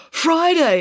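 high, loud, relieved cry of her name; excited exclamation. Acted. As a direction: call out to her through the car window. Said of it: "exclaimed Parker".

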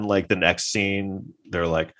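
ordinary talk

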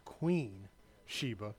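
Queen drawn out slowly and weighted; Queen stretched out with heavy emphasis; stress on Queen. Shown as a demonstration. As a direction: lean on Queen, slow and deliberate. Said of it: "let me emphasize".

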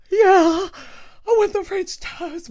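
mock-whining Mom, very high and loud; pouty whine. Daughter imitating her mom wanting French toast, pouty whine. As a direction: wail it like a sulky mom, drawn out. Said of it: "she gets a little pouty".

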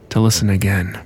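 ordinary delivery, nothing marked

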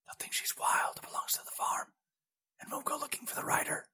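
hushed breathy whisper. A narrator's reading. Spy hiding in bushes, whispering to Turala. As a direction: whisper so the orcs can't hear. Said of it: "he murmured".

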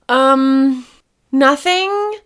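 high innocent fib; sheepish cover-up. Retold or imitated. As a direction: play it coy, pretending nothing's up. Caught waiting, covering up.

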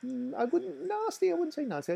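high-pitched protest. Acted. Denying being nasty, defensive.

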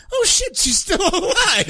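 high, laughing mock shock; shocked surprise. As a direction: gasp it out, half laughing. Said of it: "And I was like".

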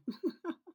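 short high real laugh. Real, not acted. The author laughs after losing her train of thought. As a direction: laugh at herself mid-outro. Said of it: "I forgot what I was saying".

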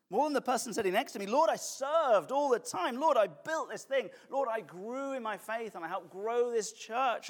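loud, high, self-congratulating plea; boastful prayer. Acted. Churchgoer listing their deeds before the throne, boastful.

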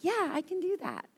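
high, eager mock-agreement; super happy. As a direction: chirp a keen yes, pitched well up. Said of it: "I was super happy".

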